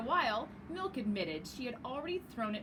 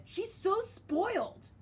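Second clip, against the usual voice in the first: high, slow and louder sneer; teasing drawn-out jab; 10 semitones higher, 4 dB louder, slower than usual. Acted. Waffle sneers at Milk, high and slow. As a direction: stretch it out to needle her.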